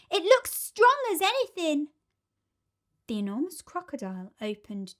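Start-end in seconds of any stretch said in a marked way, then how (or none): 0.1-1.9 put-on confident children. A narrator's reading. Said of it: "the others said".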